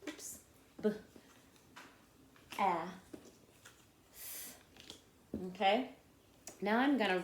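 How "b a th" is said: "B, a, th" as isolated sounds, long pauses between; sounding out. Shown as a demonstration. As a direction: spell "B, a, th" by its sounds. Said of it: "I'm gonna spell back my bath".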